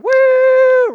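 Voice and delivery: very high, loud whooping cheer; crowd cheer. Retold or imitated. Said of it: "everybody started going".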